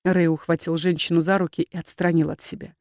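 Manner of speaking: ordinary delivery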